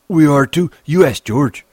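put-on high, loud childlike retort; indignant Lennie. Lennie contradicts Crooks, indignant. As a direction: shoot back high and insistent.

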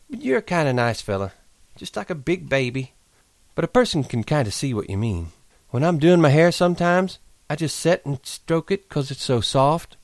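put-on high, teasing young woman; Curley's wife voice.

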